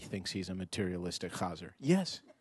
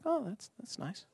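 lukewarm; 13 semitones higher, 5 dB quieter than usual. Pretend. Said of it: "sort of like".

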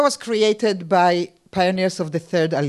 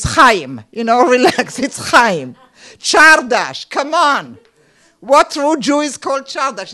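loud, incredulous scoff; exasperated aside; 5 semitones higher, 6 dB louder than usual. Acted. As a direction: come on, who believes this. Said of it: "in parentheses".

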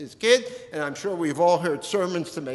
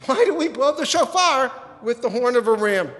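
raised, louder quoting voice; questioning rabbi; 7 semitones higher, 7 dB louder than usual. Retold or imitated. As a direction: ask it as the old rabbi.

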